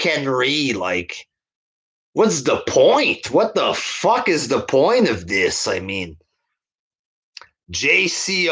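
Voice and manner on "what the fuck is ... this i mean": ordinary talk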